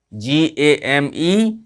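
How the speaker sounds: each letter drawn out slowly; slow spelling. Shown as a demonstration. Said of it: "spelling out slowly". (अक्षर-अक्षर धीरे-धीरे बोलना)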